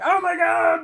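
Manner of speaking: very high mock shriek; dying vampire scream. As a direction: scream it in hammed-up agony, stretching the end. Acting out vampire being shot.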